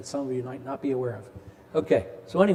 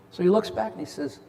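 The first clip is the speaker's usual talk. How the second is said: usual speaking voice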